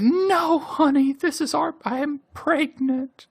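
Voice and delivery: very high, sugary pleading voice; high syrupy wife voice. Retold or imitated. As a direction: coo it to sway her husband.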